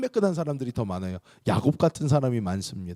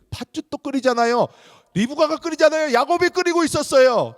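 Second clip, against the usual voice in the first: high, loud and pressed; preacher stressing Jacob cooked, emphatic; emphatic preaching; 13 semitones higher, 6 dB louder than usual. Real, not acted. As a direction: drive the point home.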